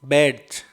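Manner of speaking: "बैड्स" ending in voiced z after d; Z sound at the end. Shown as a demonstration. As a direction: say the last sound of "बैड्स" as z, not s. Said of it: "अंत में होगा वो जय होगा". ("बैड्स" के अंत में ज की आवाज़)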